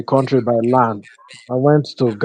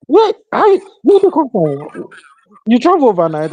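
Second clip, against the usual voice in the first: high, startled voice of an uncle; surprised uncle; 9 semitones higher than usual. Retold or imitated. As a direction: act amazed that he turned up at dawn.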